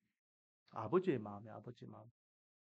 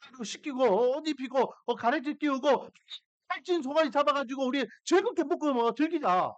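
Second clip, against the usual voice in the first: higher-pitched, excited father giving orders; fussing excitedly; 8 semitones higher than usual. Retold or imitated. Father ordering a feast for his returned son, excited fuss. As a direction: act out a dad making a joyful fuss. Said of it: "막 이렇게 호들갑을 들고 있는".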